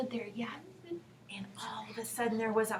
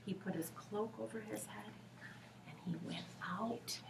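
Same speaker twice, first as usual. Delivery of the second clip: very soft whispered narration; whisper, almost nothing; storyteller's whisper; 3 semitones lower, 14 dB quieter than usual. A narrator's reading. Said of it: "the very quietest whisper".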